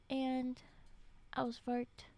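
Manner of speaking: ordinary talk, nothing marked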